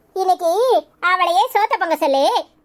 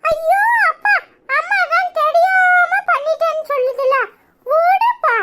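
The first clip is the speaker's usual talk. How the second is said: put-on very high, drawn-out child voice; pleading little child; 10 semitones higher, slower than usual. Child to father defending the mother, high-pitched pleading. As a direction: beg on someone's behalf like a small child.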